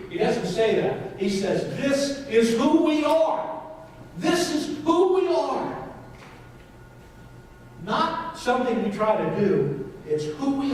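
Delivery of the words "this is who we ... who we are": high, slow and ringing, stress on who; soaring preacher's stress on who; emphatic declaration. Retold or imitated. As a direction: proclaim it as Jesus would, lifting up. Voicing Jesus emphatically.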